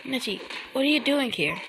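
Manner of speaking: innocent high-pitched voice; Hinata. Acted. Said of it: "In an innocent voice".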